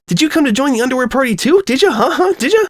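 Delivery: hyper bouncing. Acted. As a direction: pester her, bouncing with excitement. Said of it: "zipped up to her and bounced in place".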